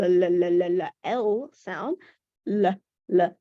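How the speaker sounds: held l, l, l repeated; l sound. Shown as a demonstration. Teacher modeling the new first sound l. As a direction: hum the l sound several times. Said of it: "we put the l".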